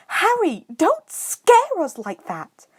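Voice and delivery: high, loud and slow; shrill. A narrator's reading. Hermione startled by Harry sneaking up, shrill. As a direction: shriek it out in alarm. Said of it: "Hermione said shrilly".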